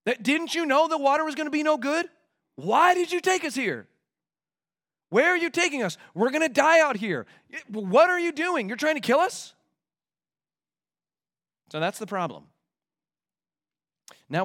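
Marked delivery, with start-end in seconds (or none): 0.1-9.5 high-pitched, agitated protest; accusing complaint. Acted. Thirsty people accusing whoever is leading them, indignant. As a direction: demand answers from the leader who failed you. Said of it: "you start having thoughts like".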